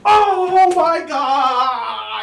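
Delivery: real joyous wail; overwhelmed crying out. Real, not acted. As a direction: voice cracking from sheer excitement. Overcome after the touchdown.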